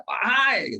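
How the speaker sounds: Wahai called out high and loud; calling out. Shown as a demonstration. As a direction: call Wahai like summoning a crowd. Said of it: "seruan yang sambil berteriak".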